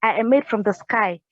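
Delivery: ordinary delivery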